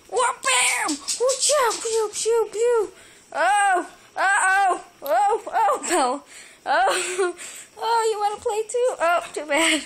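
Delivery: high, loud playful squealing; excited squeals. Real, not acted. Owner playing with the cat, squealing with excitement. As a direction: ham up the pounce with glee.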